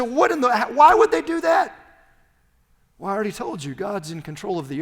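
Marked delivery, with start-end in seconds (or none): 0.0-1.7 baffled enemy cry. Acted. Enemies' baffled cry, acted.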